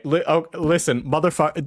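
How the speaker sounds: ordinary talk, nothing marked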